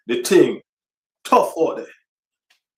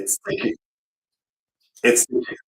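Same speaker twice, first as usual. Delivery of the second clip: whispered complaint; 2 semitones lower, 3 dB quieter than usual. Real, not acted. Fan griping about the price, whispered. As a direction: hush it out in disgust.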